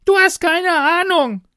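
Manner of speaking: put-on very high, loud girlish scream; roaring. A narrator's reading. Girl turns on stranger through the wall, roaring. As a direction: explode in a full high-pitched yell. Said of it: "brüllt sie".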